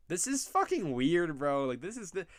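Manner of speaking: building disbelief until it breaks into a cry; incredulous rising outburst. Real, not acted. Streamer reacting to a weird [name] photo, rising disbelief.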